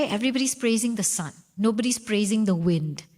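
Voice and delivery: normal talking voice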